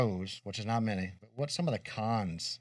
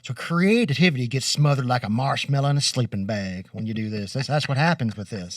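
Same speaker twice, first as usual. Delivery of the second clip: Kentucky country accent; 2 semitones higher, 7 dB louder than usual. Shown as a demonstration. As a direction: lay on the Kentucky country accent for the folksy saying. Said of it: "I'm gonna use my country accent".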